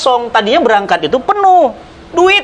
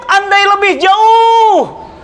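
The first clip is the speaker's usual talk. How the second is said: very slow, higher, drawn-out cry; dying cry; 9 semitones higher, much slower than usual. Acted. Dying man cries his regret, slow and high. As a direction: wail the words long and high. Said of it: "Sya'ban teriak".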